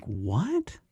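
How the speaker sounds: soft, incredulous what, sliding sharply up; quietly dumbfounded question; baffled disbelief. Retold or imitated. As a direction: let it rise, utterly lost. Retold baffled reply to accusation.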